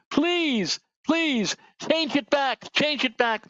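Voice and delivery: high, pleading mock plea; mock begging. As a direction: beg like a desperate state begging Washington. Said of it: "literally begging".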